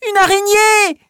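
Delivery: high, loud cry of fear; frightened yell. Acted. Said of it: "hurle Malika".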